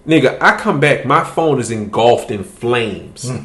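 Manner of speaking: ordinary talk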